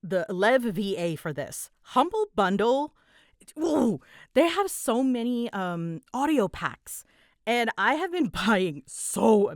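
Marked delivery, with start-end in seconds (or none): none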